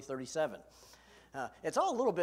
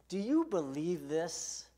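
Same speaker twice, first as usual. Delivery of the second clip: slow earnest question; 5 dB louder, slower than usual. Acted. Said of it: "can I just ask you that".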